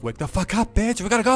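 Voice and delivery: loud, high-pitched, urgent yell; rude wake-up yell. Acted. Shrek barging in on the princess, yelling. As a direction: burst in yelling to rouse someone.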